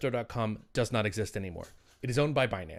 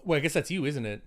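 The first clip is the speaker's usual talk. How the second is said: normal talking voice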